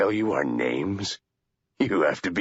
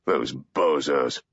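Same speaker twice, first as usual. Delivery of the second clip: low slow dismissive; rueful scorn; 7 semitones lower, much slower than usual. Acted. Wood mocking the CIA, slow disdain. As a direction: spit out the insult slowly. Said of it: "shook his head ruefully".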